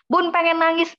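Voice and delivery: lightly voiced mum who wants to cry; pleading viewer.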